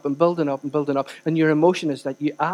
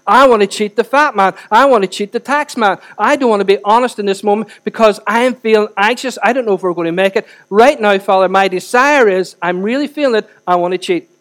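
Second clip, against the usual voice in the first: forceful acted confession, building in insistence; emphatic, pressing admission; impassioned prayer; 3 semitones higher, 5 dB louder than usual. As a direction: blurt it out to God, raised and urgent. Acted confession to God goes on.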